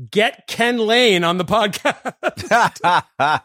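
loud, slow, laughing all-caps read; read in capital letters. Retold or imitated. Reading a listener's all-caps email line aloud, loud and slow. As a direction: belt it out like a shouted email. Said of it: "capital letters".

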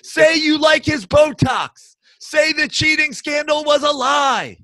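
high, hammed-up shouting; over-the-top bullying parody; mock fanatical demand. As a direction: rant demands like a crazed superfan. Mocking fans' escalating absurd demands.

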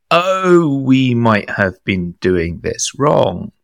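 high drawn-out "oh", then slow admission; mock realisation. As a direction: act out a slowly dawning mistake. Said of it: "We're gonna go".

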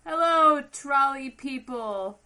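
loud, high, drawn-out hello; shouted greeting. Retold or imitated. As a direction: call out cheerfully from a passing cart. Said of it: "they were like".

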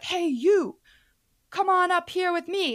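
high, loud showman call; mock stage call. Barbecue showman calling a girl onstage, high and loud. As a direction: hail her like an emcee.

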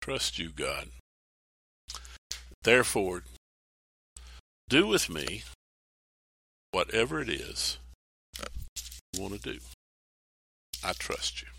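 a believer surrendering quietly to God; quiet prayer. Acted. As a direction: let go and trust, almost whispering.

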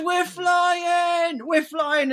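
very high, hammed-up yelling; imitated excited shout. First-time flyer to the whole plane, imitated excited shout. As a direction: mimic an overjoyed kid shouting.

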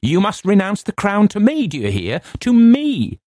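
high, exultant demand; imperious, high-pitched exultation; triumphant cry. A narrator's reading. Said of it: "with a laugh of triumph".

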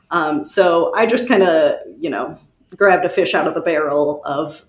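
normal talking voice

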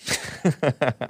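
quick run of chuckles; real giggle. Real, not acted. Host amused by his Spanish, real chuckle. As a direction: chuckle at your own attempt. Laughs at own Spanish attempt.

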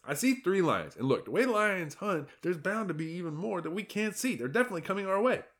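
a fretful zebra pleading to be heard; exasperated herd lookout; alarmed zebra. Acted. Hector warns of lions.

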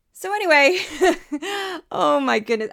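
real laughter breaking out loudly; laughing in despair. Real, not acted. Host reacting to absurd politicians, exasperated laughing. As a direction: laugh so you don't cry. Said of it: "I'm laughing to keep from crying".

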